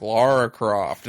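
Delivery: bad American accent. Contrasting Daniel Craig's Bond voice with his bad American accent. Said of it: "suave Bond voice".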